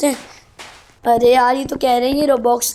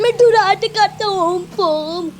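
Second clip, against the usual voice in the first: high-pitched playful boast, belted out; excited catchphrase; 6 semitones higher, 3 dB louder, slower than usual. Acted. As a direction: shout it like a silly driving catchphrase. Playful catchphrase repeated while driving. (जोश में ऊँची आवाज़ का तकियाकलाम)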